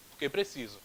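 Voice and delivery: porque clipped and soft; fast, quiet porque; quick answer. Shown as a demonstration. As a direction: rattle off the answer. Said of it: "um porquê que sai mais rapidinho".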